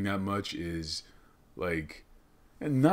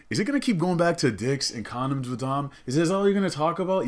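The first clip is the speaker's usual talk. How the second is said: higher, mildly hammed-up challenge; mock complaining listener; 8 semitones higher, 4 dB louder than usual. Listener complaining to the podcast host about his topics, mock exasperation.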